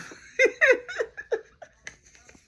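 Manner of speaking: a loud, high burst of real laughter. Real, not acted. Cracking up at own story.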